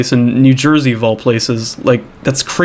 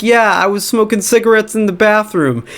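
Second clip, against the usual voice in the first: high confessing voice; 7 semitones higher than usual. Retold or imitated. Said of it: "She's like".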